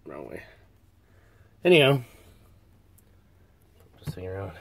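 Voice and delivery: winded and short of breath. Real, not acted. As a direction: speak while catching breath. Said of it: "Sorry to be so out of breath".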